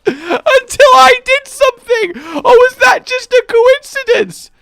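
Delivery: very high, loud, laughing-crying disbelief; high-pitched frantic yelling; exasperated wail. Real, not acted. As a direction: wail in baffled protest at the game. Baffled outburst at game freezing.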